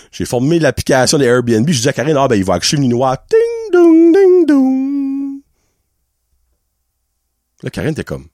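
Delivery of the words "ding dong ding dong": high sung ding-dong chime; imitated doorbell. Storyteller imitating Edouard's arrival at the door, high sing-song doorbell. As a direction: mimic a doorbell, high ringing notes.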